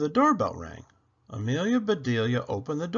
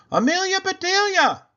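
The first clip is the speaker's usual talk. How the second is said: high, loud angry shout of her name; a furious shout at the decorated tree; 9 dB louder than usual. Acted. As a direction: yell her name across the room. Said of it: "she shouted".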